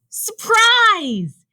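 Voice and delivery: high, loud, drawn-out surprise shout; gleeful shout, stretched long; excited yell. A narrator's reading. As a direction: yell it out to startle a friend. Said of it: "yelled Rabbit".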